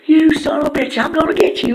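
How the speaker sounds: high, loud cartoon yell; Turd furious. Turd yelling at Fart after the slap, high and loud. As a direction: shriek the threat like an angry little cartoon. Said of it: "he's like".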